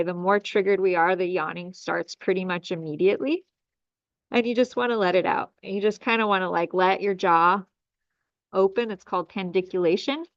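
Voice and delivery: ordinary talk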